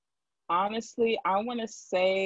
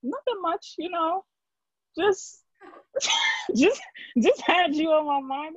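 a higher, fond grandma voice with a giggle; 11 semitones higher than usual. Retold or imitated. Grandmother calling at one in the morning, higher and laughing. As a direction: play the grandmother, chuckling sweetly. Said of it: "And she's like".

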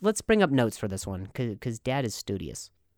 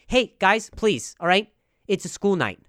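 mock scolding call; 6 semitones higher, 5 dB louder than usual. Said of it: "I go".

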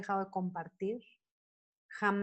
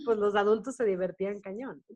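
ordinary talk, nothing marked

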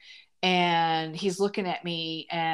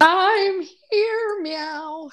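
excited squeal; 13 semitones higher, 6 dB louder, much slower than usual. Acted. Playful entrance meowing on arrival.

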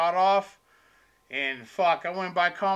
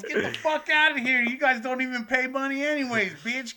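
retold angry yell; 7 semitones higher than usual. Waitress ranting the heckling table out, angry then fading. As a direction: rant them out the door, winding down. Waitress keeps throwing them out.

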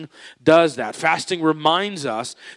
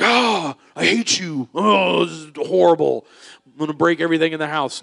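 put-on tantrum child; 2 semitones higher than usual. Pastor voicing angry hungry child.

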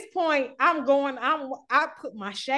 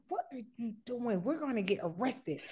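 hushed and much softer, lower; nervous friend; 3 semitones lower, 11 dB quieter than usual. Retold or imitated. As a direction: mimic the worried friend under her breath. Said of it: "she was like".

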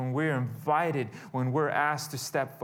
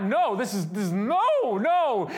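louder, higher mock screaming; retold panicked protest; 5 semitones higher, 6 dB louder than usual. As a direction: imitate a child's panicked refusal, rising in pitch. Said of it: "I start screaming out".

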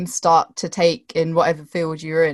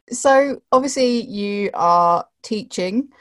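ordinary talk, nothing marked

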